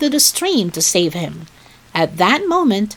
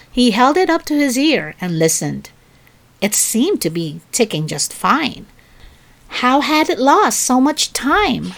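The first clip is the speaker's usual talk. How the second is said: usual speaking voice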